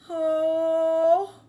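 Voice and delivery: loud, drawn-out surprised oh; stunned "oh". Acted. Boy amazed as dad eats the pie. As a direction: let the shock hang.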